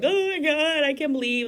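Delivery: lightly hammed-up weepy exclamation; mock crying. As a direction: act out an overemotional reaction. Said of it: "Like, not crying, like".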